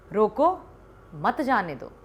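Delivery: "रोको", then a clear pause before "मत जाने दो"; pause after रोको. Shown as a demonstration. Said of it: "तो ये कैसे पढ़ेंगे हम".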